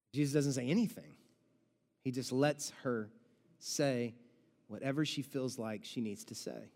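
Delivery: quiet reverent reassurance; gentle and sincere. Real, not acted. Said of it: "tender point".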